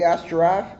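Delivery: plain reading voice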